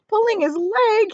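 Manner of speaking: high, loud laughing cry; gleeful cry. A narrator's reading. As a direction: crow it out wriggling with glee. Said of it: "he cried".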